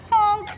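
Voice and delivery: a lonely little honk; little duck honk. Acted. Little duck answering the bullies with a honk. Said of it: "the only thing the little duck could say".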